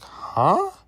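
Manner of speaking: high, loud, baffled "huh?"; incredulous reaction. Retold or imitated. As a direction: shoot the pitch up in mock disbelief. Reacts to standby news, retold.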